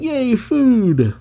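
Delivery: high, squeaky-happy burst; high-pitched mock delight; perky cheer. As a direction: perk right up with sudden glee. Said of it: "immediately perks up".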